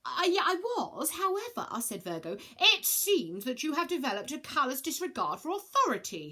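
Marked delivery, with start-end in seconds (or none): 0.1-1.7 high hesitant stammer; flustered. Acted. Virgo flustered. 2.6-6.3 indignant huff; huffy. Acted. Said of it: "huffed Ares".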